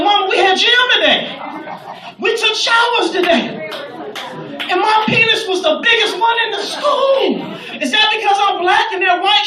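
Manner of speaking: high, loud imitation of a thrilled boy; hammed-up schoolboy excitement; excited kid. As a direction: burst out proudly like a kid telling Mama. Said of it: "he's excited".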